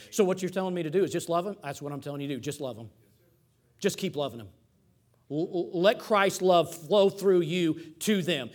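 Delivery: usual speaking voice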